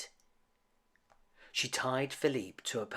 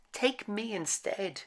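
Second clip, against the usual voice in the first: higher pleading offer; higher earnest plea; begging; 9 semitones higher than usual. A narrator's reading. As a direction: offer yourself in his place. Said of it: "she begged".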